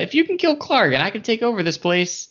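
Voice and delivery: a scheming goblin, pitched well up; sly goblin voice. Acted.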